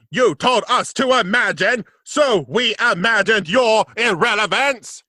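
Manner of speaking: grate it out like a Dalek. Retold or imitated. Quotes Dalek line.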